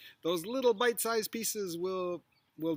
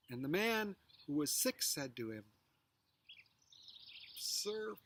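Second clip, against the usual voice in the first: scripture reading; 3 semitones higher, 6 dB quieter than usual. Acted. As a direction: read the passage softly with long pauses. Reads the passage slowly.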